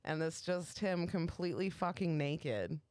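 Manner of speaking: usual speaking voice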